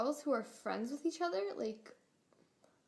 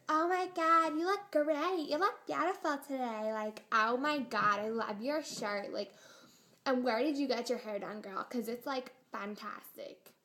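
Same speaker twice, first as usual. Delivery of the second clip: put-on gushing valley girl; 4 dB louder than usual. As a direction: lay on the flattery like a fawning fan. Everyone gushing over popular girl.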